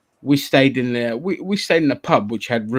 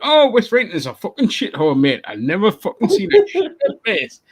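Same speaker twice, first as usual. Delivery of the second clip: high-pitched mock Geordie rant; thick Geordie; 9 semitones higher than usual. Geordie local runs down West Rayton, scornful thick Geordie. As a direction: imitate a Geordie slagging off a village. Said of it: "they had the thickest Geordie accent".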